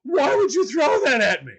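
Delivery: put-on high, loud girlish shriek, half in jest; screaming like a little girl. Man retelling how he shrieked when a party guest threw something at him, loud high shriek. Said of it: "I scream like a little girl".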